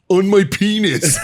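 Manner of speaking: loud, high, slow spelling-out; drawn-out retold exasperation; exasperated. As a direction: imitate finally spelling it out for someone. Finally spelling it out after repeated what.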